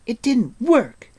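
high, loud, drawn-out wail of dismay; crying out near tears. Acted. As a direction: her spell has failed and she's close to tears. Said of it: "she cried, almost in tears".

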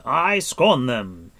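loud, high, drawn-out defiance; ringing declaration of contempt; scornful cry. A narrator's reading. Captive lord defying the queen, crying out scornfully. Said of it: "cried Gwydion".

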